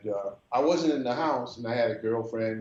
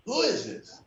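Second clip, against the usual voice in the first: put-on girlfriend's voice; 12 semitones higher than usual.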